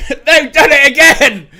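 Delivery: laughing as he cheers. Real, not acted. Laughs then repeats the cheer.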